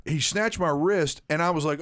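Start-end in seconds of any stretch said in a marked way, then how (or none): none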